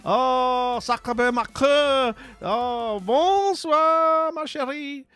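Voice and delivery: high, loud gushing French greeting; playful French. Pretend. Streamer greeting an arriving raider in French, playful loud French. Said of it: "I'll stop speaking French at you".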